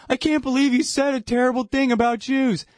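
high, whiny scandalized voice; mock outrage. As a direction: play the offended prude. Mocking offended adults.

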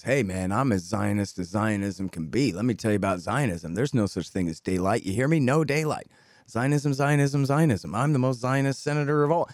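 low-pitched, insistent mock politician rant; imitated politician going off; going off insistently. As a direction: keep hammering the point, over and over. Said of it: "He's just going off".

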